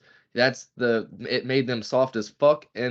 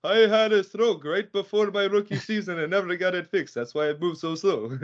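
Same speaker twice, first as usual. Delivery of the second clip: much higher put-on voice, held throughout; Joker impersonation; 8 semitones higher than usual. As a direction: put on a high-pitched mock athlete voice. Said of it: "that's probably the best impersonation I've ever done".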